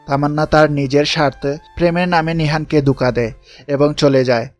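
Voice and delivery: usual speaking voice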